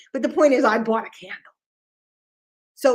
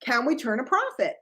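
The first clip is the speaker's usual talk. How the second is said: ordinary delivery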